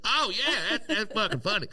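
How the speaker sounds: hammed-up high laughing audience voice; dim audience catching on. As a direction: play the crowd finally getting it. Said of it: "They'd be like".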